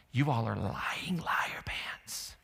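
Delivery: slow, teasing whisper. Retold or imitated. Preacher voicing Thomas, whispering a slow tease. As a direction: hiss the taunt softly.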